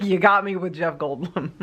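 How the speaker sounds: laughing as she gives in. Retold or imitated. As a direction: giggle through the line. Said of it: "I was like".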